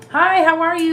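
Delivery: high, loud, chirpy hello; exaggerated small-talk politeness; chirpy greeting. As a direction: greet them in a perky high voice.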